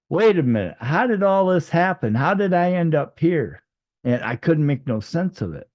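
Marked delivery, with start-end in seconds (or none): 0.1-3.6 high, insistent puzzled questions; bewildered. Retold or imitated. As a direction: demand an explanation in mounting confusion. Retold confusion about arriving in heaven.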